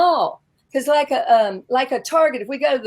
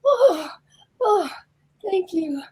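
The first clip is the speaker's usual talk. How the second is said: high, gasping little oohs of delight; mock flattered parents; 7 semitones higher, slower than usual. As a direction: imitate touched parents, pitched way up. Imitating parents flattered by compliment.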